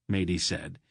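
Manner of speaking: ordinary delivery, nothing marked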